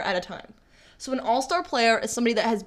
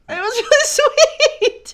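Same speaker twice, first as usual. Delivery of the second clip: laughing emotional; 6 dB louder, slower than usual. Real, not acted. Emotional about Brady speech.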